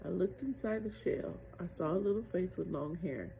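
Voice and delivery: plain reading voice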